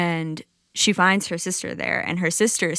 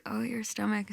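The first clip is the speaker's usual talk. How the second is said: soft echo; 3 semitones higher, 6 dB quieter than usual. Acted. Creatures quietly echoing the goddess's pain, soft murmured echo. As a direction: murmur it back gently. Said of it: "And they say".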